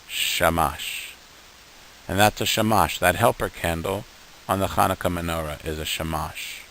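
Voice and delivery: ordinary delivery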